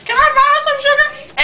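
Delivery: very high put-on friend voice; imitating friend's voice. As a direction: mimic your friend, squeaking the question. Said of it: "That's really how she talks".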